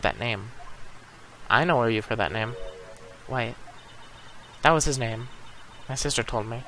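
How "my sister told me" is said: usual speaking voice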